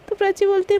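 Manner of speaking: usual speaking voice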